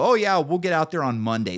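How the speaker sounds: high, casual brush-off; breezy customer service voice. Retold or imitated. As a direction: sound unbothered, like a call center rep. AC company brushing him off.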